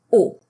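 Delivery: "O" as one pure sound; French O. Shown as a demonstration. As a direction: hold "O" steady, no glide. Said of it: "one sound in French".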